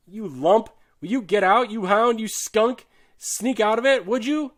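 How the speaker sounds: loud, high-pitched angry tirade; furious abuse. A narrator's reading. Soldier shaking the shirker by the neck, furiously berating him. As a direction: hurl the insults, pitch climbing. Soldier abusing the man he shakes.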